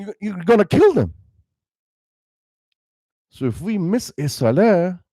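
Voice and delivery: ordinary delivery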